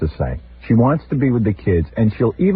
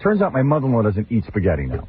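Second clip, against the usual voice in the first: ordinary delivery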